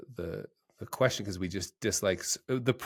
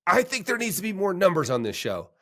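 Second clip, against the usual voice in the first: high, mock-upset complaint; a hint of a grumpy comedian whining; upset guest; 9 semitones higher, 3 dB louder than usual. Host imitating an upset writer, higher and fussier. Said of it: "he was all upset 'cause he was like".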